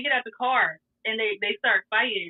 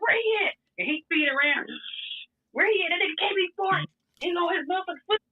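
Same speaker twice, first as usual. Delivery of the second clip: higher, heated hunting for James; fired up, chasing him down; mad boyfriend; 8 semitones higher than usual. Retold or imitated. He gets mad, hunts James.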